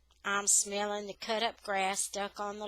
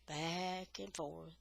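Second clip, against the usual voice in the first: slow, fading echo; 4 semitones lower, 11 dB quieter, slower than usual. A narrator's reading. Narrator echoing the dog watching the mower, slow and fading. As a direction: back and forth said slow and much softer, trailing off. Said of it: "going back and forth".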